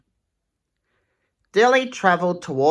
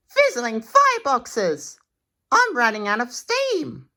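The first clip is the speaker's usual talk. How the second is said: higher, urgent engine cry; exclaiming Thomas; 8 semitones higher than usual. Acted. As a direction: blurt it out as he runs dry. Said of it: "exclaimed Thomas".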